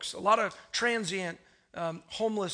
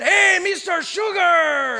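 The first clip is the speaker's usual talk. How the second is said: long, high, drawn-out holler; yelled greeting; 9 semitones higher, 12 dB louder, much slower than usual. Retold or imitated. Stumbling guy across the street to pastor, drawn-out yell. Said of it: "he would always yell it".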